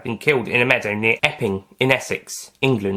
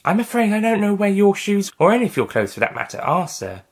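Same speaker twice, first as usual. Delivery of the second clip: lightly raised, higher female agent voice; brisk agent reporting in, voiced a little higher; a field agent briefing her boss over the phone, voiced a touch higher; Agent Hartfell; 9 semitones higher than usual. A narrator's reading. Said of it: "Hartfell said".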